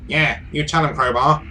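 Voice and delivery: high-pitched little henchman voice; small toady cheering his leader; scrawny sidekick. Acted. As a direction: pipe up high, egging on the boss. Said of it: "That's a great voice".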